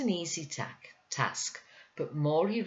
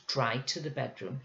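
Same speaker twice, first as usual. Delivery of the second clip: usual speaking voice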